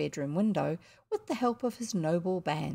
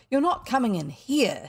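a raised, fierce cry to keep them out; 3 semitones higher, 5 dB louder than usual. A narrator's reading. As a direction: bar the door with your voice. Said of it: "she said fiercely".

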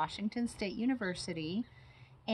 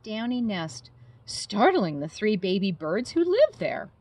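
put-on lively storybook narration for kids; reading to children; 3 semitones higher, 7 dB louder than usual. A narrator's reading. Biologist reading a bat story to children, animated. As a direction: read it like bedtime story time. Reading a picture book aloud.

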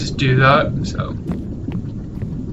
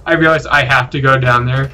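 ordinary talk

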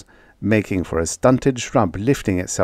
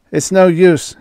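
high, loud, strained despair; exhausted cry, pitched up; panting heavily; 10 semitones higher, 7 dB louder than usual. A narrator's reading. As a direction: force it out through heavy exertion. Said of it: "he panted heavily".

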